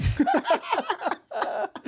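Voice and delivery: high, helpless cackle; big laugh. Real, not acted. Player cracking up at his teammate's twenty-five line, big laugh. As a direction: burst out in high-pitched laughter. Laughs hard at retold punchline.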